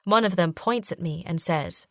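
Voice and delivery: usual speaking voice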